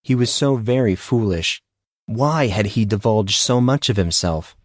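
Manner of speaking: ordinary delivery, nothing marked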